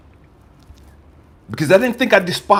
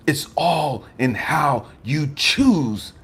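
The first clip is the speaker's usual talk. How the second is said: usual speaking voice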